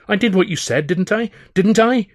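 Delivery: high, loud, rising desperate plea; panicked pleading. Acted. Charlie pleading with his rival in high loud panic. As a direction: beg to be believed. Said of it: "he stammered".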